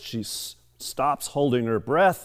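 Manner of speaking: ordinary talk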